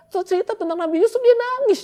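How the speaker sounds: high, loud and fast; mock tearful. Preacher mocks someone weeping at the Yusuf story. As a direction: pile it on theatrically.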